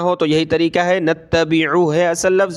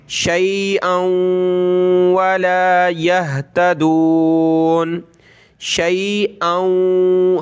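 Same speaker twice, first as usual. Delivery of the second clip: slow, drawn-out recitation; Quran recitation; 2 semitones higher, much slower than usual. Shown as a demonstration. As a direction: chant each word slowly. Recites the verse. (धीमी तिलावत)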